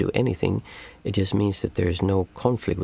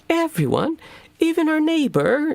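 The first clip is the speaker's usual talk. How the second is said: put-on very high, louder child's voice; astonished little girl; 5 dB louder than usual. Parent imitating the daughter asking about the neighbor, very high astonished child voice. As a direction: squeak it out like a stunned small child. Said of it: "She's like".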